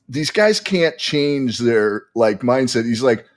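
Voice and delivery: ordinary delivery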